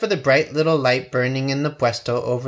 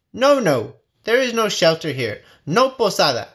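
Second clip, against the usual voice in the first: higher, louder firm refusal; stern no from inside; refusing at the door; 6 semitones higher, 4 dB louder than usual. Acted. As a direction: turn them away from the door. Doors refuse the pilgrims.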